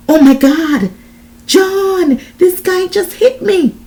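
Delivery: high, loud, shocked outcry; crying out. A narrator's reading. Desiree telling John she was hit, alarmed outcry. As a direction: cry out for help in alarm. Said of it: "I cried out".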